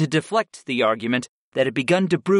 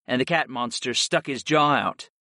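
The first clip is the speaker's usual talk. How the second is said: plain reading voice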